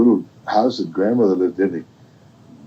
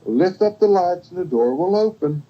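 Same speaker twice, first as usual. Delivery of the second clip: put-on high-pitched storybook girl; 8 semitones higher than usual. Girl at grandmother's door, high-pitched reply. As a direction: answer high, in a singled-out character voice.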